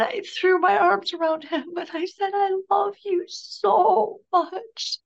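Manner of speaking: high, drawn-out, grief-stricken voice; real tears welling up, words stretched slowly; tearful. Real, not acted. Grieving mother recalling her last hug with her son, tearful.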